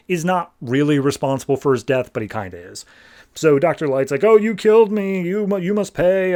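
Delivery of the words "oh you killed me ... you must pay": high, louder mock moan of vengeance; hammed-up ghost demanding payback; accusing ghost. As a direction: wail the blame in a pitched-up voice. Ghost of Doctor Light accuses Superman.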